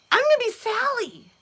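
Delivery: put-on quoting the twin daughter in a high child voice; little girl voice. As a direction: play the eager twin. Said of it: "her twin is like".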